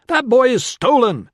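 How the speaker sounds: loud, high-pitched protest; yelling. A narrator's reading. Man fighting off a mob over a boy, yelling. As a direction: yell it out over a brawl. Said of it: "fists and boots and elbows and yelling".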